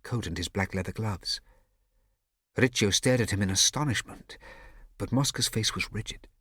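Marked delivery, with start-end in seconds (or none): none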